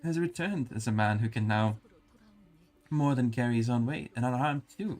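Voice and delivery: smooth over the awkward question; nervous mother voice. Acted. A mother answers the matriarch.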